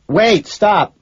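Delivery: high, loud urgent cry; shouting. A narrator's reading. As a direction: shout to halt someone walking off. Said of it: "I shout".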